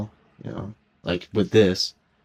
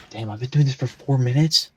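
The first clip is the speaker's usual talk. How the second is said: surprised; 5 semitones higher, 6 dB louder than usual. Real, not acted. Surprised at recording length.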